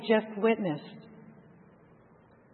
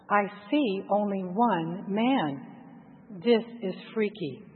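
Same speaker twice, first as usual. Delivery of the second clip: plain reading voice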